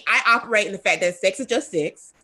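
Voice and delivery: ordinary delivery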